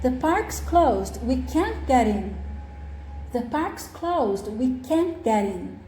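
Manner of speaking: "can't" given clear weight; slow, careful model sentence stressing "can't"; negative intonation. Shown as a demonstration. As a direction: read it slowly for learners, lean on "can't". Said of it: "é sempre a parte que tem a negação".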